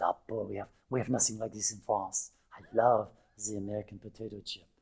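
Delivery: softly imitated French accent; hint of French accent, savouring the words. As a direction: lightly voice a French guest's accent. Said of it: "a guest coming in from France".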